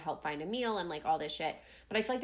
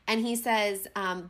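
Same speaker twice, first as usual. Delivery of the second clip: ordinary delivery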